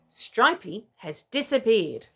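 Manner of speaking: slow, grave little chick; slow and solemn. Acted. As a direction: zeg het langzaam en ernstig. Leggy tells news slowly.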